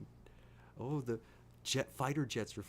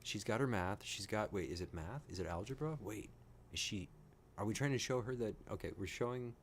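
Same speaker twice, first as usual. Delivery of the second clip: ordinary talk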